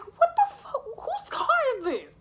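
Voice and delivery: high squeal breaking into laughter; laughing disbelief. Retold or imitated. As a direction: laugh through a high-pitched what the fuck. Confused, laughing at the chaos.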